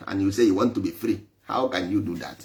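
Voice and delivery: ordinary delivery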